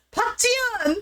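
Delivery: high, stretched-out call of a name; mock-dramatic plea, name drawn out; imitated calling after her. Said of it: "she starts walking away and he's like".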